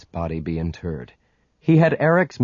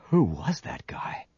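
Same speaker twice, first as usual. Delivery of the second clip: hushed unvoiced whisper; 3 semitones higher, 6 dB quieter than usual. A narrator's reading. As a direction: whisper it to yourself. Inner thought after a death.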